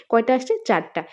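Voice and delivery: ordinary talk, nothing marked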